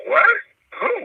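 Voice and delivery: jumps way up in pitch; shocked what. Retold or imitated. Hearing the news about a family friend, a startled high cry. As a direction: blurt it out stunned. Learning friend is sex offender.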